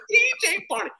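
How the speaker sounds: very high, loud mocking old man voice; Cosby impression. Comic mimicking Cosby greeting him on set, high and mocking. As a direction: squeal it out like a teasing old star.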